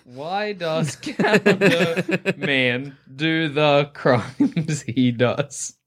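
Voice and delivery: drawn out, very slow and laughing; slow dramatic question. Pretend. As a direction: stretch each word out slowly.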